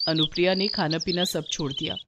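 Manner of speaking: ordinary delivery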